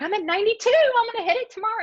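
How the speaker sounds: high, bright mock voice of excited teammates; mocking the eager teammates. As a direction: squeak it out like overexcited colleagues bragging. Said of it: "all these people are like".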